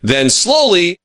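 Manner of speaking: plain reading voice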